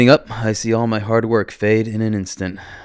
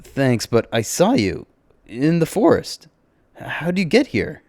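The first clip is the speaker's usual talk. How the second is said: puzzled, pitched up at the question; baffled question; 6 semitones higher, 4 dB louder, slower than usual. A narrator's reading. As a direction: ask in mild disbelief, voice lifting. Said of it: "I ask as it's the most insane thing".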